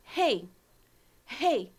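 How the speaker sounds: Rei as one syllable, said loud; loud demo of rei; joined vowels. Shown as a demonstration. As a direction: glide the e and i of rei together. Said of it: "Dois sons vocálicos juntos".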